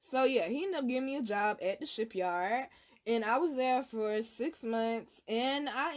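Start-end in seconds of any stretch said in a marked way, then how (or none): none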